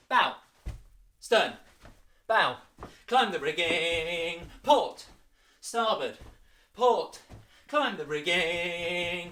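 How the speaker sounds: loud barked ship commands; shouted commands. Shown as a demonstration. Teacher shouting ship orders in the game, loud. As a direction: call each order sharply.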